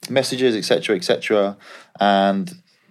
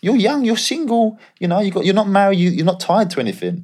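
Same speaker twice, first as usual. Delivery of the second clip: cheery Aussie agent checking in on his player; Australian agent impression; 8 semitones higher than usual. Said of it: "He goes".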